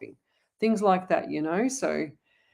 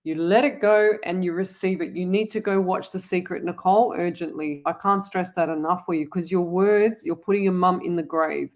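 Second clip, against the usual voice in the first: ordinary delivery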